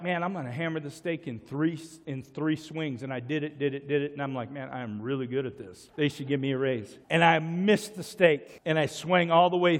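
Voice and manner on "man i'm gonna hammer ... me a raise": soft, smug self-talk under the breath; muttering his own cocky thoughts quietly; inner thoughts. Retold or imitated. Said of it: "I was like".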